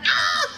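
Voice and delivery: high excited squeal; mock shriek. [name] reacting to the dance track, a high squeal. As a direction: squeal with delight.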